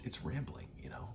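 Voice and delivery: usual speaking voice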